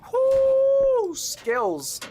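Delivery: very high, loud whoop of victory; triumphant whoop. Real, not acted. Streamer whooping after nailing a jump. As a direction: celebrate the win with a yell. Celebrating a game success.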